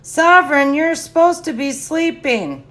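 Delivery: high, loud call across the room. Real, not acted. Calling out to someone awake.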